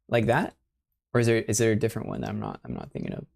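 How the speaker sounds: ordinary talk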